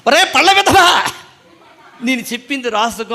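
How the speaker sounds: high, loud mock scolding of a pupil; scolding teacher. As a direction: bark it like an angry schoolmaster, half laughing. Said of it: "మా master గారు, Christopher అయ్య గారు".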